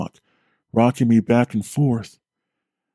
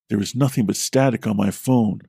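plain reading voice

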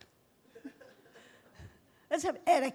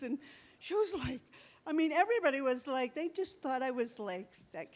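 ordinary delivery

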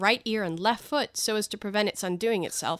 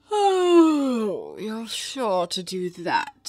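Cheshire Cat; 5 dB louder, much slower than usual. Acted. The cat reassures Alice she'll get somewhere, languid. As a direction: swoop down from a wailed "Oh", then drawl.

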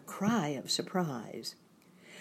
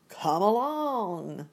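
loud, drawn-out, high hearty call; big cheerful scarecrow welcome; 4 semitones higher, 5 dB louder, slower than usual. A narrator's reading. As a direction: call it out heartily, stretching the words. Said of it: "said the Scarecrow heartily".

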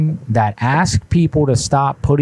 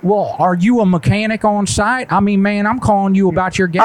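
higher, indignant put-on caller voice; prank caller voice; 8 semitones higher than usual. Prank caller to the gas station, put-on higher indignant voice. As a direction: play a fussy customer who got hung up on.

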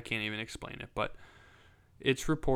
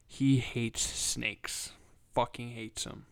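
ordinary talk

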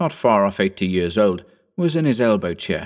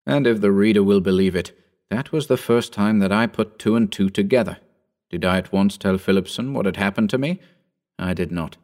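plain reading voice

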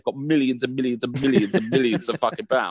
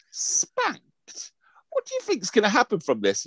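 incredulous; 9 semitones higher, slower than usual. Retold or imitated. Shocked at daughter's words.

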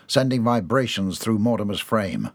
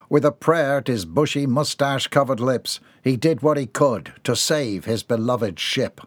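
plain reading voice

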